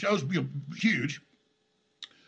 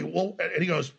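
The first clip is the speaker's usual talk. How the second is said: ordinary talk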